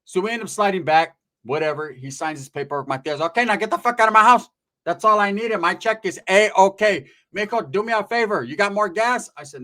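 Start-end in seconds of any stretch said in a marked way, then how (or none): 3.2-9.3 higher, louder tia voice; acted-out brash auntie; bossy aunt. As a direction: order your nephew about. Tia orders him around.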